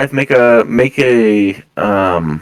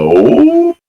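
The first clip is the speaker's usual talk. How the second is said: surprised oh; 4 dB louder than usual. Acted. Guards react to noble's name.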